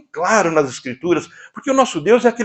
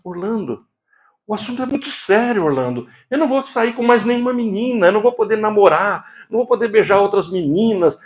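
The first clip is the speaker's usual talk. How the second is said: a higher, tearful-sounding plea, half-acted; retold crying plea; 7 semitones higher than usual. Patient tells his therapist he is afraid to marry, mildly tearful. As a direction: voice the anxious groom close to tears, not real sobbing. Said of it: "ele chega a chorar e fala assim".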